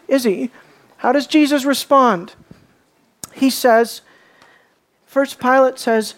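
usual speaking voice

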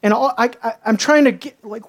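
ordinary talk, nothing marked

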